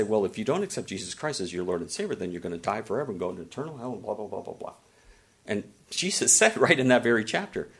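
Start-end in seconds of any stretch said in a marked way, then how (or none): 0.0-4.7 fast, rattled-off mock hellfire warning trailing into blah blah; mimicking people who like to warn about hell; mocking preachy voice. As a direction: parody a judgmental preacher, then fade off. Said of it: "'Cause people like to say".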